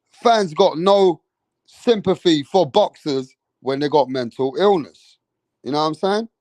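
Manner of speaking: normal talking voice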